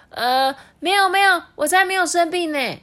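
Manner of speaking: put-on high-pitched boy, hasty denial; high child voice. A narrator's reading. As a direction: deny it fast like a kid. Child denies being sick. (慌张否认的小孩)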